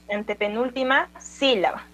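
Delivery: usual speaking voice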